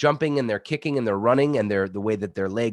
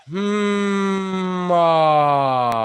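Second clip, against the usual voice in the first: high resonant hum opening into a loud ah; resonant hum then ah; 8 semitones higher, 8 dB louder than usual. Shown as a demonstration. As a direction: hum high, then open to a full, powerful ah. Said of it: "make this sound on the out breath".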